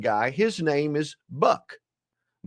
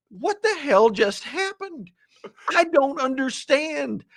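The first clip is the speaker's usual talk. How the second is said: baffled; 8 semitones higher, slower than usual. Retold or imitated. Baffled inner thought.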